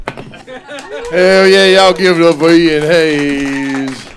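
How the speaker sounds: loud, slow announcer call; hyping the crowd. Real, not acted. Host calling for applause for Ian Hayes, loud and drawn out. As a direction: bring the comic off big and loud.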